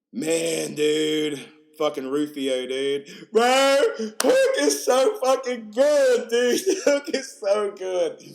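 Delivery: loud, high, drawn-out raving; real overexcited shouting with a laugh; excited gushing. Real, not acted. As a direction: holler your love for it. Gushing about the film Hook.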